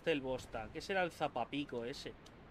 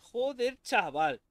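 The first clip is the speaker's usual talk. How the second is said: louder exasperated curse; loud outburst; 6 semitones higher, 7 dB louder, much slower than usual. Real, not acted. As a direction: bark it out in frustration. Muttered then shouted same words.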